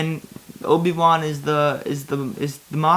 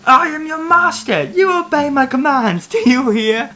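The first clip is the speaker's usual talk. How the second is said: high furious command; 13 semitones higher, 6 dB louder than usual. Acted. Obi-Wan commanding, angry.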